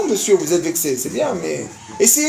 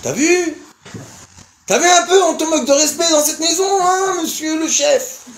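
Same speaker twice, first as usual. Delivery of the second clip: mocking tempter voice; 8 semitones higher than usual. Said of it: "Et il me dit".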